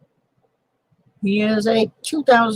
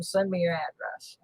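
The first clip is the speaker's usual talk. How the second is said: normal talking voice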